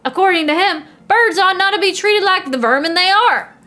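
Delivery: high-pitched, indignant outburst; indignant rant. Acted. Editor scoffing at a bird defender's views, indignant. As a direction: work up into scornful outrage, pitch climbing. Editor ranting about birds.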